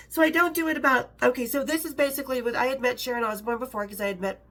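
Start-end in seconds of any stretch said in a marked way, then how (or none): none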